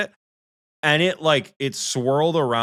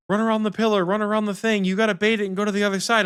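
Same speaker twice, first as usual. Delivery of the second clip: high, rushed frantic instructions; urgent Michael; 9 semitones higher, faster than usual. Retold or imitated. Imitating her teammate coaching her mid-fight, fast and high. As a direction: rattle off his hurried tips. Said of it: "and Michael's like".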